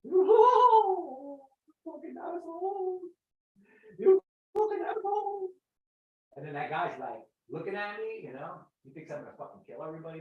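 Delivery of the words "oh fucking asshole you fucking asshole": high, loud mock wailing; retold blubbering. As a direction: blubber the curses at a high pitch. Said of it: "I was bubbling there".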